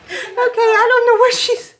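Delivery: high, loud, slow words broken by sobs and laughs; crying through laughter. Real, not acted. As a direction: laugh and cry at once, overwhelmed. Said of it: "break down like that".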